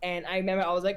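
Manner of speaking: ordinary talk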